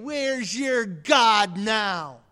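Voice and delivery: slow, sneering taunt; a crowd mocking the condemned, slow and sneering; mocking jeer. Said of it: "jeering and mocking".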